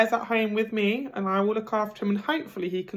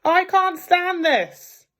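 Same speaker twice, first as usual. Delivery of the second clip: much higher, louder, slow fed-up wail; exasperated; 10 semitones higher, 6 dB louder, slower than usual. Acted. Mr Bear woken again, high slow wail. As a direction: whine it out slowly in despair.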